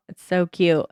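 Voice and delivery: normal talking voice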